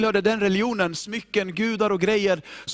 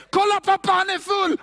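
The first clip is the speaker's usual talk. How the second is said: much higher and louder, jeering; a jeering kid's voice; mocking son; 11 semitones higher, 5 dB louder than usual. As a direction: call out gleefully, pointing at dad. Son mocking his drunk father.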